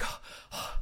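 voiceless, soft panting; imitated breathlessness. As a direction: gasp like someone who just ran in. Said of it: "out of breath".

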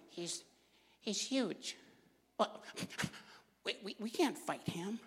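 frightened whisper. Retold or imitated. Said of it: "the Israelites are going".

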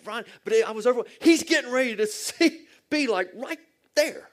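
high and loud, almost laughing; bursting with it, voice jumping up; excited plea. Retold or imitated. Pastor urging dying friend, high excited retelling.

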